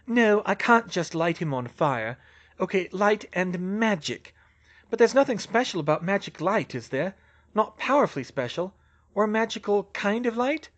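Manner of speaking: lightly acted problem-solving; Hermione thinking aloud. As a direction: reason it out aloud, pitched a little up.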